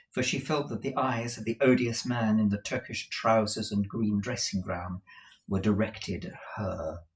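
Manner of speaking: ordinary delivery, nothing marked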